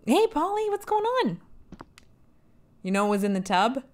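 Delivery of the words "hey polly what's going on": much higher, sing-song hello; high cutesy greeting. Pretend. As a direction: greet her in a high, playful voice. Greeting a game character.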